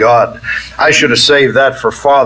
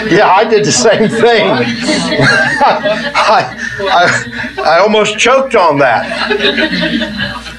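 higher-pitched, talking through laughter; amused, chuckling between phrases; laughing; 7 semitones higher, slower than usual. Real, not acted. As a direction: let real laughs break up the words. Laughs at own quip while speaking.

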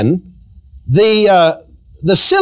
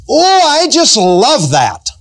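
gushing mock delight; 7 semitones higher, slower than usual. Preacher mimics gushing people.

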